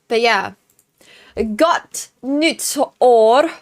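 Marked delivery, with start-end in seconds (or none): 1.6-3.5 Gott nytt år spoken very slowly, each word drawn out, rising at the end; carefully sounding out Gott nytt år word by word; slow Swedish demo of Gott nytt år; Swedish greeting. Shown as a demonstration. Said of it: "how to say Happy New Year's".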